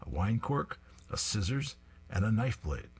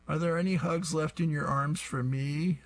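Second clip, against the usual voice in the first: put-on little kid voice; 7 semitones higher than usual. Little boy Jeffy asks mom.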